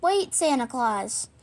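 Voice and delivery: plain reading voice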